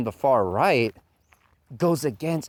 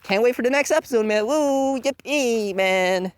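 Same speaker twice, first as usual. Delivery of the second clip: higher, fast, gushing fake excitement; mocking hype fan; 10 semitones higher, 4 dB louder than usual. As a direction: rattle off empty fan cheers. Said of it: "they're like".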